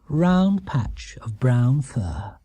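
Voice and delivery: ordinary delivery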